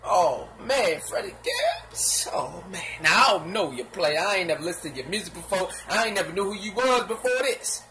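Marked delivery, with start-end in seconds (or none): 1.0-2.3 laughing taunt. Real, not acted.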